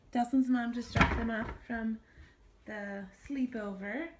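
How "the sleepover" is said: ordinary delivery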